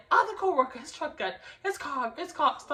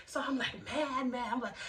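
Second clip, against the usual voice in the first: normal talking voice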